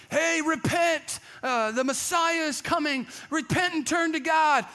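high, slow, shouted warning; mock street preacher crying out; street preacher call. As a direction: call out to passersby to repent. Disciples preaching on the road.

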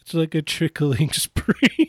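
high, slow words broken by giggles; can't get it out for laughing; laughing through it. Real, not acted. Joking about a sweat stream, laughing while speaking.

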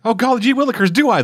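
put-on high, eager little boy voice; gee-whiz kid voice. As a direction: squeak it like an overjoyed kid. Sick kid answering Batman.

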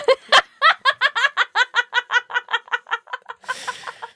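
high, long, creepy cackle; an accidental evil cackle; sinister laugh. Real, not acted. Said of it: "you have the most incidentally sinister laugh".

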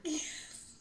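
whispered yes. Retold or imitated. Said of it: "She's like".

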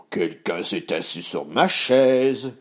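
slow bear roar, building at the end; roaring Papa Bear. A narrator's reading. Papa Ours finding his chair used, slow mild roar. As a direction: draw it out and swell on the last words. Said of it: "hurla Papa Ours".

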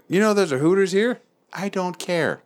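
high, mocking dudebro voice; mocking the guys. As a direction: ham up the buddies asking about Hooters. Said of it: "the guys I was with were looking for shit like".

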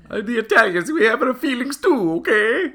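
high, loud offended Italian voice; mock Italian accent. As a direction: protest as a hammy Italian.

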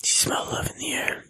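breathy unvoiced whisper. Friend teases about love in a whisper.

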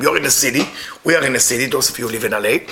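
ordinary talk, nothing marked

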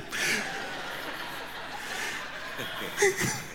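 an airy, voiceless chuckle, high; soft breathy laugh. Real, not acted. Storyteller laughing at his own mishap, airy chuckle. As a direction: laugh it off under your breath.

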